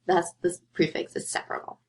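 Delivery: usual speaking voice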